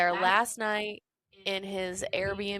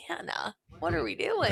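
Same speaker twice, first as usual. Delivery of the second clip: high, breath-light whispered plea; softly imploring, lightly acted; retold hushed plea; 8 semitones higher, 3 dB quieter than usual. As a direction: imitate an intimate, pleading half-whisper. Retold pleading with upset Hannah.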